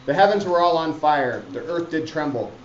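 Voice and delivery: loud, declaiming Glendower's boast; booming stage recitation; dramatic reading. Acted. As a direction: proclaim it grandly to the class.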